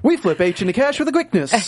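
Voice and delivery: cheesy TV spokesman; infomercial. Pretend. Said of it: "sounds like an infomercial".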